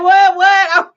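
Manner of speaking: high, loud startled "what, what"; mock shocked client. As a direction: play the client caught off guard, loud and high. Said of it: "He was like".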